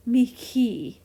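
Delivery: "mihi" said mee-kee, slowly. Shown as a demonstration. Teacher gives another Latin word, "mihi" with K. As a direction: put a hard K in the middle of "mihi". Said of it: "and it's the same. Instead of an H".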